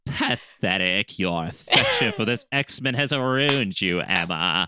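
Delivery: slow, low, drawn-out villainess delivery; theatrical villain, lingering on each word; Celine. Acted. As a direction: stretch out the disdain in a low put-on voice. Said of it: "I love doing Celine's voice".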